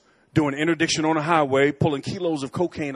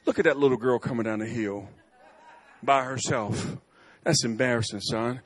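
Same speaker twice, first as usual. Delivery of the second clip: teasing laugh; 6 semitones lower, 5 dB quieter than usual. Retold or imitated. Father teases son laughing.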